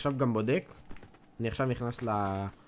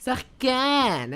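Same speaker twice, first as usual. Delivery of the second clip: very slow, high, stretched-out שחקן; mock-grand brag; 10 semitones higher, 4 dB louder, much slower than usual. Bragging about his own play. (שחקן נמשך לאט ובקול גבוה בהתרברבות)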